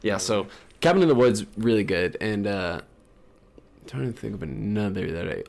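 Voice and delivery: normal talking voice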